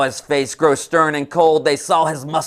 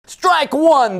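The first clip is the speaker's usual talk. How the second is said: high, loud umpire call; ringing call of strike one; umpire's call; 13 semitones higher, 5 dB louder than usual. Acted. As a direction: bark it out like an umpire. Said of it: "the umpire said".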